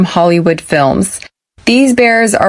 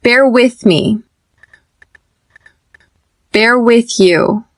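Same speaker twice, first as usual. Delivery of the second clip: slow, careful drill; slow conjugation drill; slower than usual. Shown as a demonstration. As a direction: say each form slowly for students to echo. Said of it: "Repeat after me".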